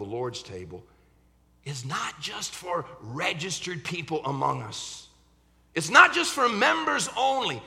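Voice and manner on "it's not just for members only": preaching. Acted. Sermon build to full force.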